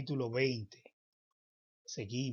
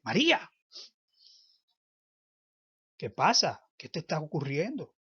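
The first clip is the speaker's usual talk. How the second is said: calling her name; 3 semitones higher, 7 dB louder than usual. Acted. Jesus calls Mary to react.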